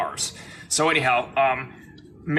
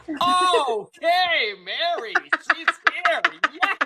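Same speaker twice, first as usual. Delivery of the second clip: high, loud, amazed and laughing; 13 semitones higher, 4 dB louder, much slower than usual. Retold or imitated. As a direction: squeal it with delighted disbelief. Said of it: "I was like".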